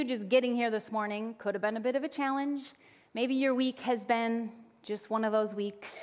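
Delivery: ordinary delivery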